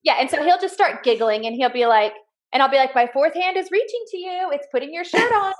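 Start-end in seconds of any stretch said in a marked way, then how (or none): 2.9-5.5 sing-song mock magic, pitched up; playful teasing, higher. Mom teasing her son while helping him dress, playful and higher. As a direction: tease him like it's a magic trick.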